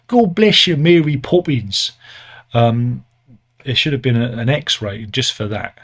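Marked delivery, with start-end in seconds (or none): 0.1-1.9 loud, high bad Cockney accent; mock Cockney accent. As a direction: ham up a terrible Cockney accent.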